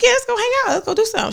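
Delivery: high-pitched eager quote; eager agreement. Retold or imitated. Retelling saying yes to a birthday outing, eagerly.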